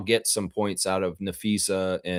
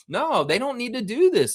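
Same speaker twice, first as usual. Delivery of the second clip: ordinary talk, nothing marked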